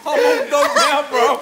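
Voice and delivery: hyping himself up, cracking up; laughing brag. Real, not acted. Repeats it louder, laughing.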